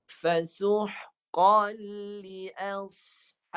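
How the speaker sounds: teacher reciting for a pupil to copy; Quran recitation demo. Shown as a demonstration. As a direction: chant it as a model for the student, sad full and heavy. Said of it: "Dengar saya baca".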